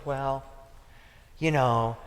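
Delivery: soft, low, halting mock coach; imitated laid-back, quiet excuse-making; indifferent coach. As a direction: play a coach who shrugs it off. Imitates the easygoing coach's reply.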